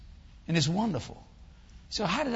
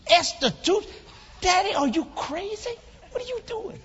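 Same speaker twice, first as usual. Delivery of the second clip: much higher, incredulous questioning; acted shocked disbelief; 12 semitones higher than usual. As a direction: squeal it in comic disbelief at Dad. Retold shock at father's news.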